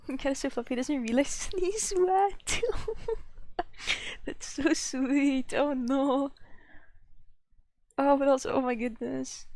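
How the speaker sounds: giggly cooing. Real, not acted. Laughing at the soggy cat.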